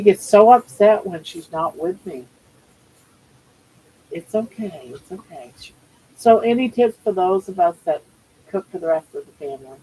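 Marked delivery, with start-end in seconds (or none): none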